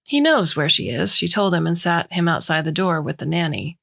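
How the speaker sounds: ordinary delivery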